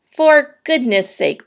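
slow, high exasperated outburst; bellowed. A narrator's reading. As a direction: stretch each word in exasperation. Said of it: "bellowed Mister Zuckerman".

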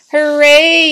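high, loud crowd hooray; drawn-out high yell of joy; yelled cheer. A narrator's reading. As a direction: cheer it out at full voice. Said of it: "yelled the crowd".

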